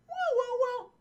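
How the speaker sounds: high hooting chirp; little creature's high warble; imitated dinosaur call. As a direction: hoot like a small dinosaur.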